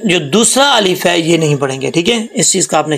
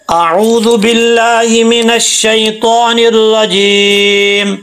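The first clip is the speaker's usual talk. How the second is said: Quran recitation; 5 semitones higher, much slower than usual. Acted. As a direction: chant it with tarannum, stretching every vowel. Recites Surah al-Asr melodically. (ऊँची, धीमी, सुरीली तिलावत)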